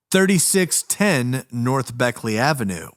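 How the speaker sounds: usual speaking voice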